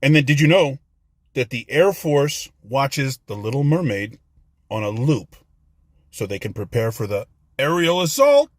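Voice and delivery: normal talking voice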